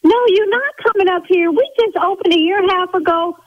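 high-pitched, indignant outburst; worked-up yelling. Real, not acted. Salon owner challenges caller threatening to come up, yelling indignantly. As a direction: voice shoots way up in outraged disbelief. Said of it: "See, now I'm yelling".

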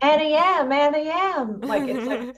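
high, loud transatlantic accent; heightened old-Hollywood transatlantic accent. Shown as a demonstration. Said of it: "this weird heightened thing".

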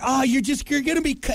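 retold alarm. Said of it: "I'm like".

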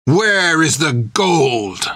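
slow, drawn-out threatening question; slow menace. A narrator's reading. Creech demands the gold, slow and threatening. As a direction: lean on every word. Sword at her neck.

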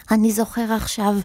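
strangled, throat-tight voice; choked voice. A narrator's reading. Wizard recalling his past with hands on his throat, choked. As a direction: speak as if the throat is squeezed. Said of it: "אמר בקול חנוק".

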